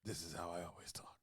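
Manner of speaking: soft, low gruff mutter; gruff Sean Penn voice. Retold or imitated. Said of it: "in his superhero voice".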